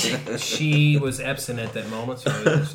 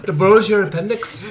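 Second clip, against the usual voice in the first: chuckling through a loud mock Scottish cheer; laughing Scottish accent; 8 semitones higher, 9 dB louder than usual. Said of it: "that was a weird Scottish accent".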